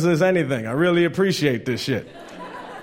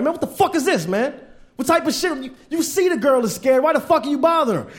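high, heated retelling of outrage; indignant comic rant in a raised pitch; mad rant; 9 semitones higher than usual. Retold or imitated. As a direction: confront the guy, voice climbing. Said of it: "And I was mad".

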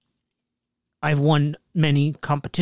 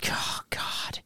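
whispering; 14 dB quieter than usual. Real, not acted. Groaning at the poll.

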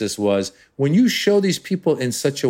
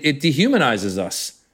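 ordinary talk, nothing marked

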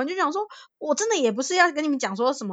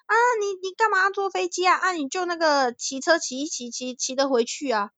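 put-on higher, puzzled Taiwanese-accented elder; Taiwanese-accented Mandarin; 7 semitones higher than usual. Elder telling her to ride home, in an incredulous Taiwanese accent. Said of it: "那个讲台语那个腔调".

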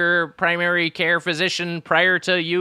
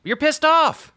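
egging on; 12 semitones higher, 4 dB louder, slower than usual. Real, not acted. Egging friend to vent.

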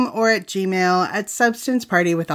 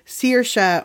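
ordinary delivery

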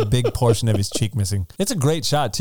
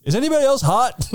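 high, strained "Is anybody else hot?"; mock sweaty soldier; 12 semitones higher than usual. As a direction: squeak it out like he's overheating. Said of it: "He's like".

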